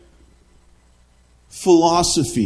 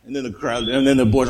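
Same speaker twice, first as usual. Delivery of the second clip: normal talking voice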